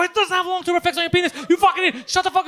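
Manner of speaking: high, loud exasperated scolding; heated, high-pitched telling-off; retold yelling. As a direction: yell down the phone at an idiot friend. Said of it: "Just yelling on the phone".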